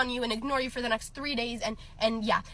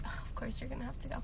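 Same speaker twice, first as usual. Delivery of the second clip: much softer and fast, sulky groan; sulky mock whine; 11 dB quieter, faster than usual. Girl imitating her ex sulking when she hangs up. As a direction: sigh it out like a sulky boyfriend.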